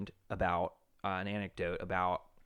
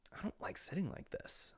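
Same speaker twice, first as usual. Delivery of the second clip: breathy imitated whisper, much higher; 11 semitones higher, 9 dB quieter than usual. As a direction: whisper it like a secret complaint. Rabbi voicing chicken's complaint.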